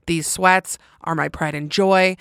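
usual speaking voice

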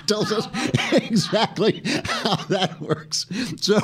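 high, slow words through giggles; helpless laughter breaking up the words; laughing. Real, not acted. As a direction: lose it halfway through the sentence. Cracking up at own joke.

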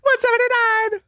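high excited shout of the number; excited squeal. Retold or imitated. Friends trading Legally Blonde lines, high excited squeal. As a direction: squeal it like Elle Woods. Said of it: "huge for line reads".

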